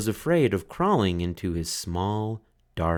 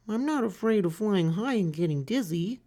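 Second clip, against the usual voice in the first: high, timid little turtle voice; small turtle Franklin; 13 semitones higher than usual. A narrator's reading. Franklin asks the duck for help, high and timid. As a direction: ask like a shy child.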